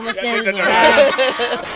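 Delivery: drawn-out, loud emphasis on every word; slow emphatic. Real, not acted. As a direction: lay it down slowly with sass. Emphatic repeat of boast.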